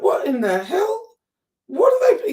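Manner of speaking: high, loud, horrified questions; horrified disbelief. Retold or imitated. Daughter seeing her dad laid on his side, horrified. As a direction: voice leaping up in shock. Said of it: "I was like".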